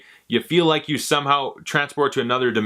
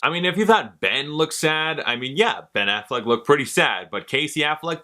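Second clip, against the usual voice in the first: normal talking voice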